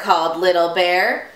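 ordinary delivery, nothing marked

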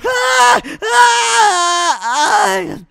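high, loud drawn-out "ah"; strained wail. Acted. Eater finishing a record attempt, strained wail. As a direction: wail out the effort, easing off. Collapses after eating contest.

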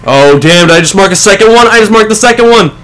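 normal talking voice

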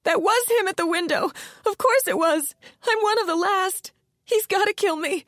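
a frightened wail; terrified witness whining to detectives. Acted. Ilona panicking about the killer.

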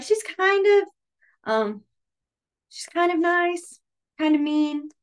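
sing-song a teeter-totter opinion; high see-saw example voice; wavering so-so voice. Shown as a demonstration. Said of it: "So you'd be like".

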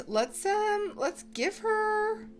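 ordinary delivery

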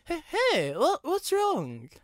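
Riley finding the brake van sobbing; concerned Riley. A narrator's reading. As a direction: gently ask what's wrong.

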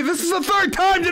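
shouting. Acted. Heated exchange.

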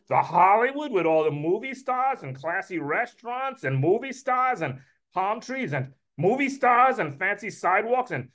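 put-on starstruck Brooklyn accent, pitch climbing high; Uncle Murray. Uncle invited to Hollywood, giddy Brooklyn accent. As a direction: gush in Brooklyn tones, building to a high question. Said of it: "Me, I give him a Brooklyn accent".

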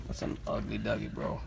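low unvoiced whisper; whispered aside. Real, not acted. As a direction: whisper it behind his back. Aside about toy.

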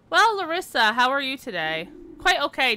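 high, bright bedside manner; cheery doctor voice. Acted. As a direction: greet the patient like a chipper doctor. Doctor greets patient brightly.

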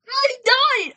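high, loud pretend death yell; hammed-up dying shout; mock death cry. Pretend death in toy play.

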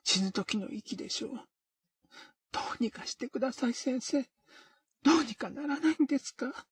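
rising into a frantic plea; panicked plea. Acted. O-kei begs the doctor, growing panicked.

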